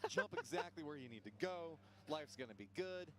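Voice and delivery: lively play-by-play commentary; esports caster. Acted. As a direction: call the match like a caster.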